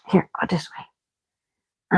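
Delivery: soft slow whisper. Real, not acted. Whispering slowly to guide the dog.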